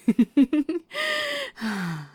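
high, loud gloating giggle; gloating laugh. Acted. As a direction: laugh in triumph at him.

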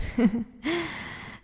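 rising gleeful cackle; wicked laugh. Acted. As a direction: laugh with cruel delight. Mocking helpless victim.

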